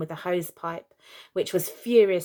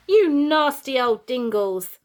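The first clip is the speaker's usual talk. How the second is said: slow, slightly louder jeer; mild nasty scolding; 5 semitones higher, 6 dB louder, slower than usual. Acted. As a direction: sneer it out slowly like a spiteful mum. Mother pelting kids, insulting them.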